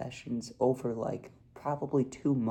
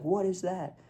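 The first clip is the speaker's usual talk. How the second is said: higher, startled questions as grandpa; shocked grandpa; 7 semitones higher than usual. Retold or imitated. Grandpa surprised by the new puppy, higher startled asks. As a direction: play the grandpa seeing a puppy for the first time. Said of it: "He was just shocked".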